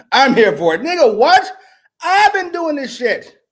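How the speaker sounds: high, loud, incredulous hype; worked-up rant. Real, not acted. Heated rant cheering a promo.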